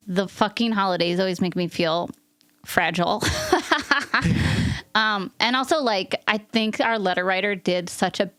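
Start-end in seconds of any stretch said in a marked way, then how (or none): 3.3-4.9 laugh. Real, not acted. Host laughing at her own emotion.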